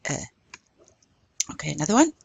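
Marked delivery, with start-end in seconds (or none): 0.0-0.2 Eh like the vowel in "red"; short open Eh; accent grave sound. Shown as a demonstration. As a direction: say Eh as in English "red". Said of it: "like the English word red".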